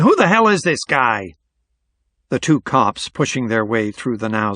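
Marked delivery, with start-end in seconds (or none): 0.0-1.4 high, indignant heckle; baffled, irritated onlooker calling out; raised voice from the audience; shout from a crowd. A narrator's reading. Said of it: "some murmurings and scattered shouts".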